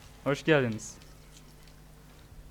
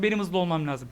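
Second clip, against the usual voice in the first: ordinary talk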